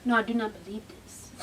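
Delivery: low, soft, smooth-talking man; smooth suitor. Retold or imitated. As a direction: imitate a man laying on the charm. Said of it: "y'all should have heard him".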